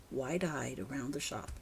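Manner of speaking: ordinary delivery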